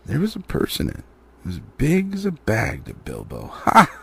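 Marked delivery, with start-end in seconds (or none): 3.5-3.9 pleased ha. A narrator's reading. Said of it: "he thought, warming to his new work".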